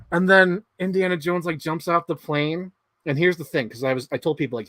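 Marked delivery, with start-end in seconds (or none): none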